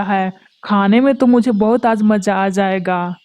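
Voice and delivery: a greedy kite relishing its meal; menacing, savoring every word; gloating predator. Acted. The kite gloats over the two trapped birds, menacing.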